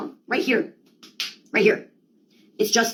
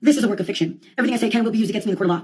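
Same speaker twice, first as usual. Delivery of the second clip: ordinary talk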